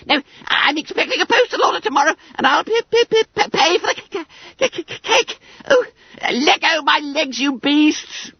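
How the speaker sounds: high stammering wail; stuttering panic. A narrator's reading. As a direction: stutter while shaken about. Bunter shaken, stuttering.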